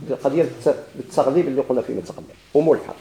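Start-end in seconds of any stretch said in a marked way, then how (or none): none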